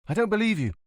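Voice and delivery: put-on high, anxious girlfriend pressing him; suddenly agitated. A narrator's reading. Livia suspects Montalbano is in danger, agitated. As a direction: wake up and press him, worried.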